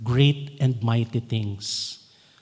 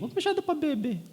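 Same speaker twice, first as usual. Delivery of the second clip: mock whiny voice; 12 semitones higher, 6 dB quieter, much faster than usual. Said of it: "Crying mode lagi".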